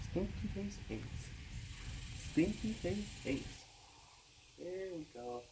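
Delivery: soft high cat-talk. Real, not acted. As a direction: sweet-talk the cat gently.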